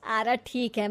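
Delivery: ordinary talk, nothing marked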